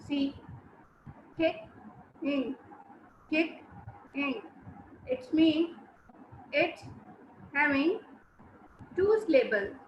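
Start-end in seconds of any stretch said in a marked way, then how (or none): 1.4-4.4 sillabe staccate. Shown as a demonstration. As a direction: KICK and IN as two separate syllables, a pause between. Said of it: "How many sounds are coming from my mouth".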